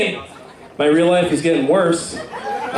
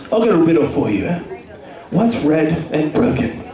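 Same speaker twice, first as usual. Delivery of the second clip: cheesy tough-guy riddle. Pretend. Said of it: "He's like".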